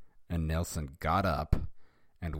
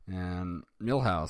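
plain reading voice

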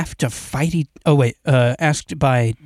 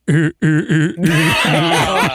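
Ari impression; much slower than usual. Friend mocks Ari by stammering, a light put-on voice. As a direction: stutter like you're lost for words. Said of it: "now my impression of Ari".

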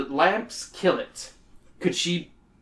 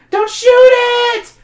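very high, loud cry; panicked shout; 12 dB louder than usual. A narrator's reading. Girls screaming at their mother not to shoot the cat, high and loud. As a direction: scream it to stop her. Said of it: "the girls chorus behind them".

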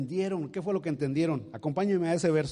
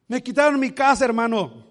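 loud, high lament about the lost house; mock complaint; 8 semitones higher, 9 dB louder than usual. Believer telling a brother his house was taken. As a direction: cry it out to the brother.

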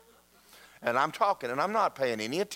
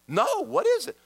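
high, louder mock demand to know; insistent question; 4 dB louder than usual. Businessman over lunch pressing the pastor, imitated insistence. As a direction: imitate someone pressing for the answer.

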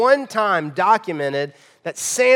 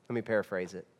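ordinary talk

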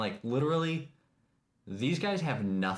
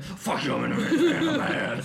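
high-pitched, louder mock rant, laughing through it; mock angry dad; 11 semitones higher, 6 dB louder than usual. Mocking angry minivan dads to a friend, high laughing rant. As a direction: squeal it like a furious minivan dad.

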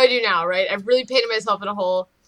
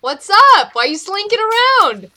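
high, raised calling voice; talking so loud; 7 semitones higher than usual. Acted. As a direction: call out loudly as if across the street. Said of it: "I'm still talking so loud".

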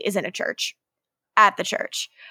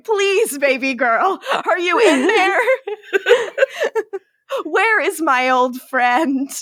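higher, laughing mock plea, slowing at the end; mock pleading; 6 semitones higher, slower than usual. Podcaster shaking Elena by the shoulders, laughing mock plea. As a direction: beg her to snap out of it, hammed up.

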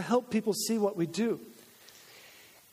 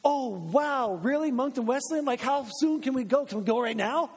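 higher, brighter gushing as an eager friend; mock eager excitement; 4 semitones higher than usual. Preacher imagines an eager friend's reply, mock excited. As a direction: play the keen friend who can't wait to come. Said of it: "the first response might not be".

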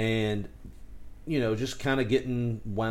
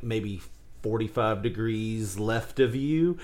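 ordinary talk, nothing marked

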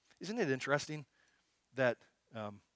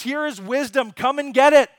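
loud, high call across the street; Wisdom calling out; 10 semitones higher, 15 dB louder than usual. Acted. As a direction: call out to everyone passing by. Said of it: "she's just crying out in the middle of the streets".

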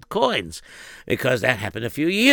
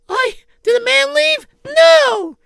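gentle wife voice; 4 dB louder, slower than usual. Acted. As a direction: ask politely in a high, hesitant voice. Wife answering husband.

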